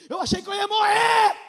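high, loud cry of despair; emotional outcry. Real, not acted. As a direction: cry it out as if breaking. Emotional climax of sermon. (grito emocionado, alto e agudo)

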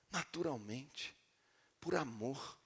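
hushed, low and slow; soft loving reassurance; tender explanation. Acted. Preacher explains obedience comes from love, hushed and tender. Continues the gentle Spirit reading.

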